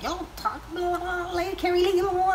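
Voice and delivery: high rattled-off hype voice; fast-talking hype intro. Pretend. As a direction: rattle it off like a hired hype man.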